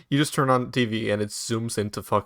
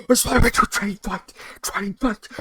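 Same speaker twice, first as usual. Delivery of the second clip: gasping high-pitched mock squeal; imagined hyped reaction; 10 semitones higher than usual. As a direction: imitate an over-excited fan freaking out, very high. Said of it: "I can just imagine the reaction".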